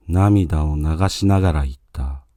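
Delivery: usual speaking voice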